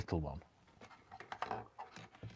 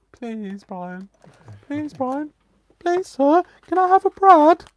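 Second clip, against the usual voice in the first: higher and louder, building to a squeaky plea; mock pleading; 14 dB louder, slower than usual. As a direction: beg like a whiny kid, rising each time.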